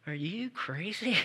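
hushed, slow mock disbelief; incredulous bank. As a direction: act out a banker balking at a loan. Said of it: "the bank looked at me like".

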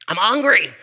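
loud mock wail of a hungry baby; crying baby. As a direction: cry it out like a baby demanding food. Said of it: "He was crying saying".

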